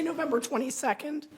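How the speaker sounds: normal talking voice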